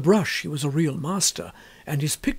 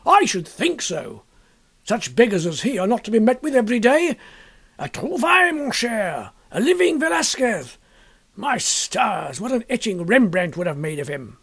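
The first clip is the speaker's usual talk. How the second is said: exuberant artist, raised and loud, leaping high on the French; enthusiastic painter; 5 semitones higher, 3 dB louder than usual. Acted. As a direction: gush over the find like a delighted painter. Trevor enthusing about the model.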